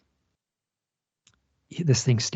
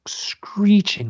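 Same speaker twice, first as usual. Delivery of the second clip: higher, whispered screechy imitation of the owl noise; imitated screech; 8 semitones higher than usual. Imitating the owl's screech while telling the story, whispered high. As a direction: rasp the word out like a screeching bird. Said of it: "horrible noise coming out of the woods".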